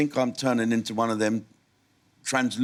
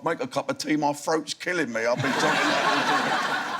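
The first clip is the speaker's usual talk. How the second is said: a light mock West Indian accent, slowing down; lightly mocking West Indian accent, half laughing; 3 semitones higher than usual. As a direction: complain about a sore throat in a lightly put-on West Indian accent, laughing. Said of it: "go home and say to your wife".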